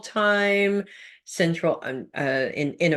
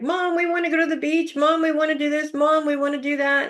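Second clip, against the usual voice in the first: put-on high, fast pleading kid voices; whiny kids; 9 semitones higher, faster than usual. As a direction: beg like little kids wanting the beach. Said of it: "all the kids are in the kitchen and they're like".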